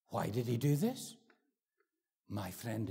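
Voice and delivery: normal talking voice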